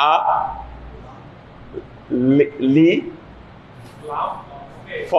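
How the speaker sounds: "আ লি, লি ফ" broken apart with long gaps; syllable-by-syllable alif. Shown as a demonstration. Teacher breaking alif down for learners, syllable by syllable. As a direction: sound out "আ লি, লি ফ" piece by piece. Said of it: "কোনো টান নাই".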